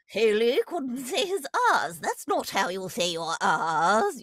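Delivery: slow, loud posh British teacher correcting. Retold or imitated. Teacher correcting a student's R's, posh British accent. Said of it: "sort of the same voice I did for".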